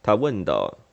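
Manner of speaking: ordinary delivery, nothing marked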